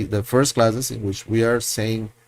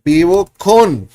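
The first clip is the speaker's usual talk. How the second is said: Vivo con slow, high and loud; slow loud model; 6 semitones higher, 8 dB louder than usual. Shown as a demonstration. Teacher modeling Vivo con to the class. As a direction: lay out each word for learners. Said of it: "teacher models slowly".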